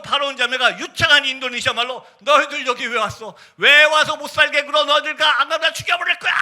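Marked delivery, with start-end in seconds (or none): 2.2-6.3 retold possessed threat, climbing high. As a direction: imitate a possessed woman screaming her threat. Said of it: "막 소리 지르기 시작합니다". (귀신 들린 자매의 외침 흉내)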